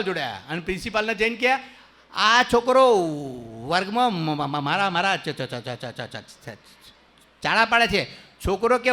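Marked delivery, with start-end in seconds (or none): 2.1-8.0 stuck on ચ- repeated, fading, then ચાળા pops out; teacher stammers a complaint; mock stammer. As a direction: stammer to the principal.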